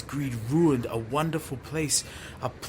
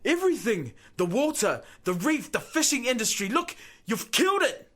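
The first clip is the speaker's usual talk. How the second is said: rising furious yell, higher and louder; furious outburst; 10 semitones higher, 3 dB louder than usual. Acted. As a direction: hurl the accusation at them. Harold rages over ruined reef.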